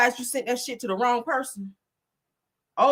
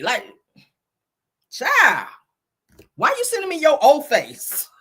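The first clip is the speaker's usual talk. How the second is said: higher, louder, slow outraged attorney; imitating city attorney; 8 semitones higher, 4 dB louder, slower than usual. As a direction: drag out the disbelief. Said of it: "City attorney's like".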